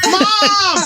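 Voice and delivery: high, desperate call; calling mom. Retold or imitated. Son in the bathroom calling to his mom for help. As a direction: yell for mom to save him.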